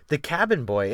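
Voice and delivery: ordinary delivery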